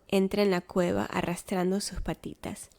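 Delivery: ordinary delivery, nothing marked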